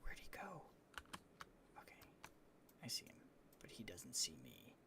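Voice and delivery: hushed unvoiced whisper, low on the last words; sneaky whisper. Real, not acted. Hiding from the cat, sneaky whisper. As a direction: creep up on him in a whisper.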